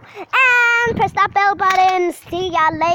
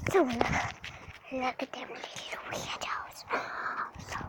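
whispering; 4 semitones lower, 16 dB quieter, slower than usual. Real, not acted. Whispering about the neighbours.

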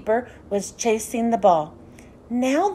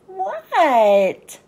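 1 Froggy's call back; 4 semitones higher, 4 dB louder than usual. A narrator's reading. Froggy answers his father.

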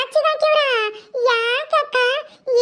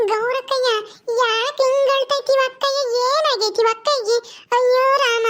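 put-on childlike Paddu, concerned. Paddu finds Gowrakka crying, childlike concern. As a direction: fuss over a crying elder sister in singsong concern.

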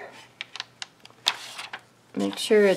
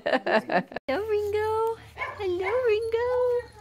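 soft, very high singsong greeting; cooing pet talk; 12 semitones higher, 6 dB quieter, slower than usual. Real, not acted. Owner saying hello to puppy Ringo, cooing pet talk. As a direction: coo it high to a little puppy.

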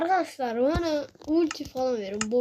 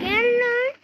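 taunt; 9 semitones higher, 3 dB louder than usual. Real, not acted. Düşmanı kavgaya çağırıyor.

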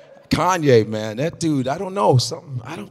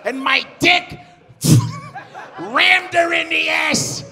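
much higher, shouted, slow stand-up screech; Gilbert Gottfried impression; 9 semitones higher, 4 dB louder than usual. Comic imitating Gilbert's stage voice for the crowd, high shouted screech. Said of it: "You know how you be like".

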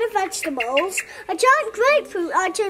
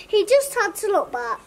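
plain reading voice

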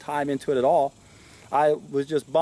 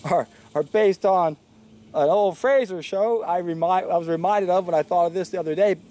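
normal talking voice

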